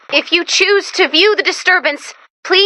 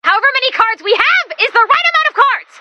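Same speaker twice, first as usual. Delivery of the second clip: exasperated outburst; 10 semitones higher than usual. Acted. Exasperated outburst over card game.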